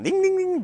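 high sing-song ding; bell chime. Acted. Ringing a bell to call Fenris, high chime.

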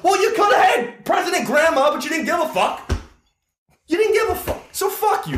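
shouting angrily about the election; heated shout. Real, not acted. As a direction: yell it in their face. Shouting at non-voters.

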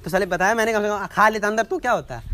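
high, loud indignation; loud, exasperated comeback; indignant retort. Real, not acted. Arguing about phone in mouth.

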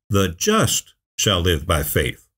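"just" drawn out and set apart, slow delivery; stress on "just". Shown as a demonstration. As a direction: lean hard on "just", then let the rest fall away. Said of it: "say it with emphasis".

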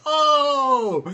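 high, loud startled oh; the penny drops, high and loud; sudden realization. Retold or imitated. Teen ringleader realizing the kid is the brother, high and loud.

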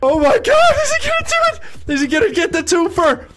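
high, ecstatic shouting; screaming-proud commentator hype; pumped-up cheering. Real, not acted. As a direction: lose it over a player's win. I'm so pumped!.

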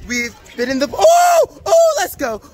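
shrieking gasps in icy water; gasping from cold. Real, not acted. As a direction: yelp at the freezing water. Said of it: "Oh my God, it's so cold".

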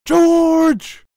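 very high, loud, drawn-out yell; mock shout. Host quoting Rampage to his co-host, mock shout. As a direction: holler the name long and high.

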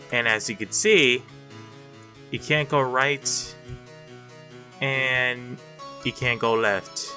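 ordinary talk, nothing marked

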